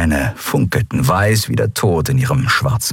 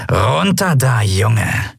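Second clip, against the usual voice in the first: pirate growl; 3 semitones higher, much slower than usual. A narrator's reading. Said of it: "knurrte die Piratin".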